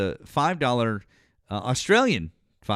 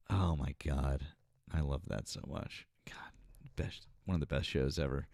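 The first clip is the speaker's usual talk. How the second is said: soft unvoiced whisper, almost to himself; 7 semitones lower, 10 dB quieter than usual. Real, not acted. To the chat about a favorite show, whispered. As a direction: breathe it out in a hushed whisper.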